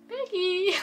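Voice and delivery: high, put-on call of a bratty girl; Ambre-style voice. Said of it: "je lui fais à peu près la même voix que Ambre". (voix aiguë à la Ambre)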